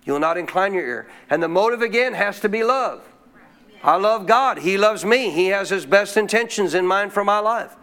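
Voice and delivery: usual speaking voice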